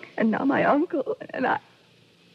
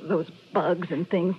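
ordinary talk

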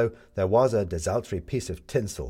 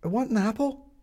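put-on high child voice; 14 semitones higher than usual. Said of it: "said Midge".

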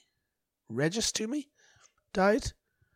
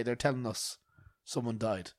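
ordinary talk

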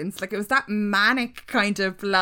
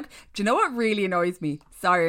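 normal talking voice

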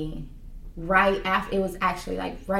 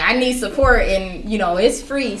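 mock refusal; 3 semitones higher, 8 dB louder than usual. Retold plea for support.

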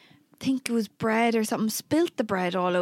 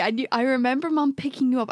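ordinary talk

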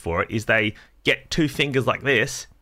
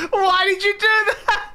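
laughing mock wail; slower than usual. Mock despair at friend.

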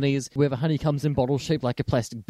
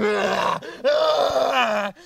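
imitated bear roar; 7 semitones higher than usual. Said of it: "just starts going".

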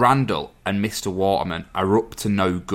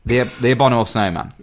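rushed attempt at a tricky word; said quickly; much faster than usual. Shown as a demonstration. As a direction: say "abominable" quick, after a false start. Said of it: "say it quick".

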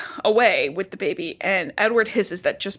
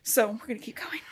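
whisper; 8 dB quieter, faster than usual. Real, not acted. Whispered aside.

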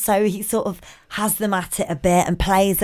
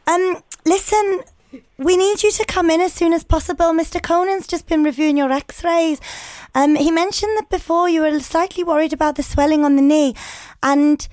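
high, louder put-on Scouse voice; hesitant, official-sounding prank in Scouse; Liverpool-accented secretary; 8 semitones higher, 5 dB louder than usual. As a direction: deliver the news as a fake Scouse receptionist. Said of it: "I'm gonna pretend I'm the secretary".

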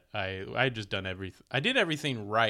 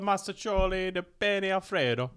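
higher, louder hammy fake Italian; fake Italian accent; 9 semitones higher, 5 dB louder than usual. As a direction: sell it like a cheesy Italian waiter. Fake Italian food line.

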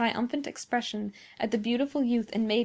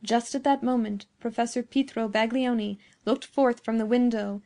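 usual speaking voice

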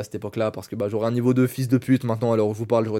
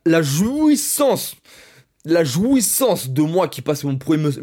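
ecstatic; 6 semitones higher, 5 dB louder, slower than usual. Retold or imitated. Ecstatic memory of first muscle-up.

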